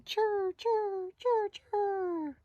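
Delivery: high, trilled squirrel chirr; squirrel chatter. Acted. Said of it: "here's a little squirrel saying".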